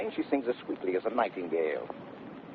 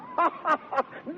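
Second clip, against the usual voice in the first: high hearty laugh; high booming stage laugh; big laugh. Acted. Laughs at her jibe.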